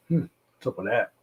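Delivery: normal talking voice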